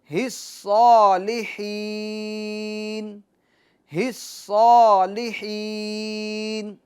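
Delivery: الصالحین drawn out slowly with a clear ha; slow recitation. Shown as a demonstration. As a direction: stretch الصالحین slowly, marking each letter. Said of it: "recitation drill".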